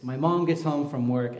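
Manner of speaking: ordinary delivery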